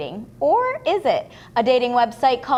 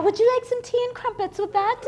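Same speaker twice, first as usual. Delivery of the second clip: higher-pitched playful British lilt; mock British accent; 10 semitones higher than usual. Host offering tea to the acting student, mock British accent. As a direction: put on a teasing British accent.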